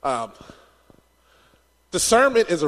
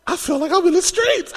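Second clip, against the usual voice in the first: mock panicked thought; 11 semitones higher, 3 dB louder than usual. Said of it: "I was like".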